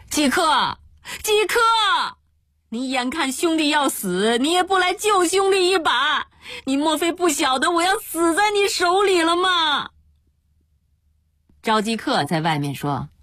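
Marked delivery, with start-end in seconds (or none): 0.1-9.9 high-pitched, drawn cries for rescue; desperate calling out. Acted. Addict begging his friend to save him, desperate cries. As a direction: cry out desperately, voice climbing high. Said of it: "一边又大叫".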